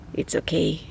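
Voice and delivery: normal talking voice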